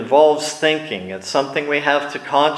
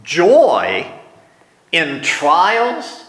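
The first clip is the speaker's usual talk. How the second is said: rising disbelief on trials; incredulous; 3 semitones higher, much slower than usual. Pretend. Preacher reacting to James's command, incredulous. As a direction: scoff at the very idea. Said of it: "This is the way I want to react".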